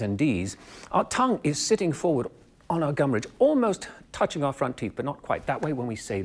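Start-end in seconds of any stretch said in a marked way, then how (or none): none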